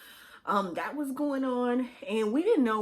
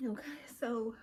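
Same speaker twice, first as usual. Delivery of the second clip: tearful; 2 semitones higher, 9 dB quieter than usual. Real, not acted. Composing herself after crying.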